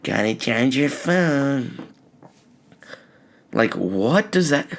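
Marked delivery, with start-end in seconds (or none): 0.0-1.9 slow, softer mocking mimicry; mocking mom. Son mocking his mom's phone advice, slow and sarcastic. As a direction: drag it out sarcastically. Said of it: "That's what I'm thinking".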